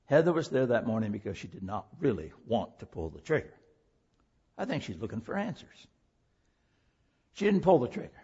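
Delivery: normal talking voice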